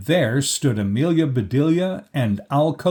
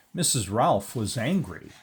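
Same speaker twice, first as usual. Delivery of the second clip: ordinary delivery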